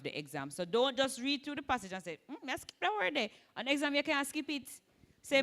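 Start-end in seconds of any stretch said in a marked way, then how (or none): 2.3-3.3 lightly voiced student, higher pitch; a hint of a dismissive student, pitched up; mock student. Teacher imitating a student reading through the passage, mock student. Voicing a student skipping a word.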